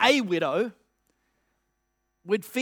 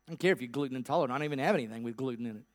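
rapid retort; 8 semitones lower, 9 dB quieter, much faster than usual. Retold or imitated. Widow rattles off retort.

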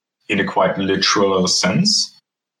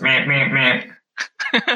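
mocking blah blah; 3 semitones higher, much slower than usual. Mocking buzzer at absent friend.

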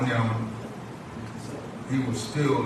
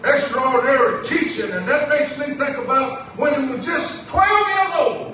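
raised, emphatic sermon voice; preaching; 14 semitones higher, 7 dB louder than usual. Acted. Preacher marvels to congregation at the boy Jesus' teaching, preaching. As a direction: preach it out, rising on the key words.

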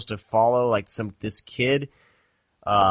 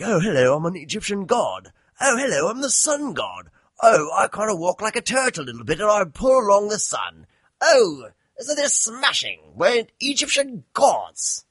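high, hammed-up English accent, much higher pitched; mock posh English; 8 semitones higher than usual. Critic mocks movie's English-accented gods, high silly English. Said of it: "everyone else is like".

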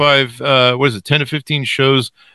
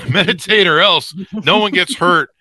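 laughing; 7 semitones higher than usual. Real, not acted. Jokey threat repeated laughing.

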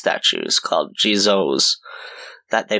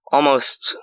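usual speaking voice

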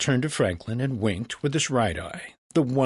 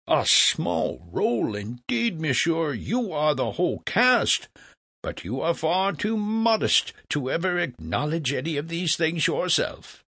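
put-on higher-pitched, theatrical old philosopher; flattering Franklin; 7 semitones higher than usual. Franklin butters up Legras, fawning and theatrical. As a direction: lay the flattery on thick.

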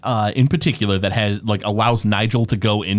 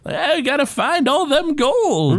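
high, slow hammy gold-hungry miner; grizzled prospector; slower than usual. Retold or imitated. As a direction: ham up an old gold-rush prospector. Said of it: "like, a grizzled prospector".

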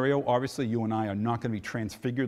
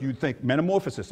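usual speaking voice

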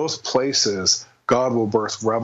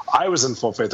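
normal talking voice

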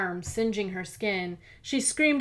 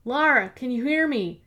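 loud, higher call; cried out; 5 semitones higher, 5 dB louder than usual. Acted. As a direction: call out to her urgently. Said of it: "a muffled voice cried out".

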